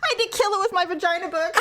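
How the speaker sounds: laughing through the words. Real, not acted. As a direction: giggle while telling it. Laughing about spider.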